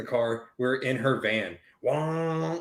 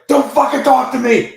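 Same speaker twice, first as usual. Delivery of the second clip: sudden high shout, much louder; yelled; 14 semitones higher, 13 dB louder than usual. Retold or imitated. Swollen-lipped dad yelling at his son in the bathroom. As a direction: bark it like you're panicking.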